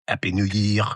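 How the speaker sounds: French-accented New Year greeting; mock French accent. Shown as a demonstration. The family's running joke greeting, French accent. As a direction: imitate the French caller, lightly. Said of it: "that is how my family says".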